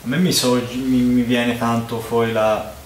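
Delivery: muffled by glass rim; talking while sniffing drink; speaking into a glass. Real, not acted. Seen 0: Left man talks with goblet rim at nose and mouth.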